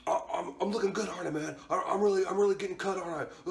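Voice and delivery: high, stammering mimicked guy voice; mock a bro fishing for compliments; needy show-off.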